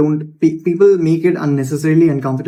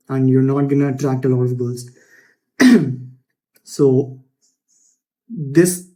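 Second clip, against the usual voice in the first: ordinary delivery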